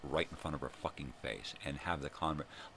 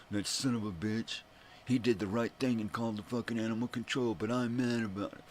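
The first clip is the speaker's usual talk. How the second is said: higher, louder mock whiny neighbor; 8 semitones higher, 5 dB louder than usual. Mocking imagined neighbors griping about the call, sneering parody. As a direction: parody a self-righteous neighbor. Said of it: "repercussions from asshole neighbors".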